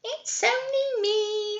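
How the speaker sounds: high, sung little goat voice; tiny kid goat calling out sweetly. A narrator's reading. Small goat announcing himself on the bridge, high and sung. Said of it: "said baby Billy Goat Gruff".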